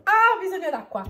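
strained right after choking; gasping for water after a cough; choking on a drink. Real, not acted. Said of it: "mi è stata la saliva di traverso".

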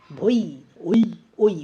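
ভৈ then ওই as separate loud "oi" glides; diphthong demo. Shown as a demonstration. Teacher showing the half vowel, ভৈ and ওই each said apart. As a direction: isolate ওই, full o sliding into a short i. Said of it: "ওটা সম্পূর্ণ উচ্চারণ হচ্ছে".

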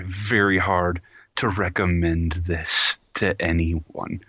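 ordinary talk, nothing marked

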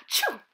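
high sudden mock sneeze gasp. Narrator acting a classmate's sneeze. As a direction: fake a big sneeze.

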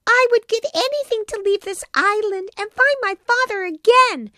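put-on high, pleading puppet-boy voice, lightly weepy; weeping. A narrator's reading. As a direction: beg through tears like a sorry little boy. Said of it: "he wept".

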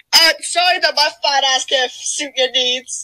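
ordinary delivery

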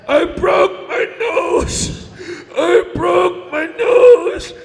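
slow, high, blubbering whine; hammed-up wailing. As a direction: bawl like a big baby. Giant wailing over broken nose.

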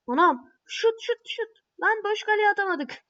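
high, loud urgent yells at the screen; frantic shouting. Real, not acted. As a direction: shout "şut" like the goal depends on it. Urging a shot, then missing.

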